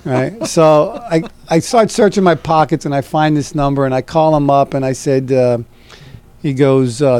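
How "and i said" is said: ordinary talk, nothing marked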